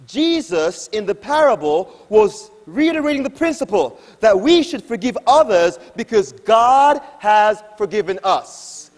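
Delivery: normal talking voice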